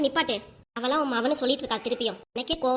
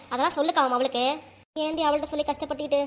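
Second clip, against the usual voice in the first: normal talking voice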